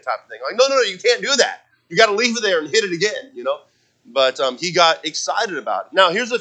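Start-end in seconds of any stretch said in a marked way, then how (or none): 0.6-3.3 higher-pitched, hurried dad protest; retold urgent objection. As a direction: re-enact a father stopping his son. Dad stopping his excited son.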